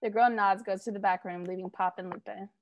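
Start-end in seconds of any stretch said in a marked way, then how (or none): none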